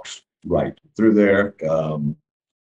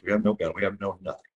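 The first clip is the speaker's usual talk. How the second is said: much softer, urgent near-whisper; quiet scared pleading to his son; hushed plea; 9 dB quieter, faster than usual. Retold or imitated. Pleading with son in dark woods.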